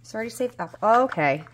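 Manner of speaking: ordinary talk, nothing marked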